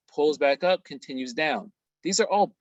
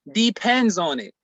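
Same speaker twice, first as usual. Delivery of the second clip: "Depends" punched loud on its first syllable; "Depends" stressed hard, then falling away; emphatic repetition; 3 semitones higher, 5 dB louder than usual. Real, not acted. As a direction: hammer home "Depends" as a warning. Repeats the warning for emphasis.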